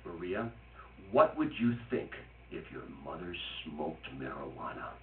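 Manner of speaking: low, hushed, grave mother; serious mother tone. Retold or imitated. Mother questions her daughter Maria, in her most serious tone. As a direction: quietly lay down a solemn parental question. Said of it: "in her most serious, I am your mother tone".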